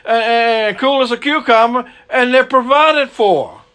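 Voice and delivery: ordinary delivery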